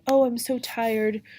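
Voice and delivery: hammed-up weary nurse; tired nurse. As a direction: play up the tiredness, slowly. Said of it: "the nurse is like".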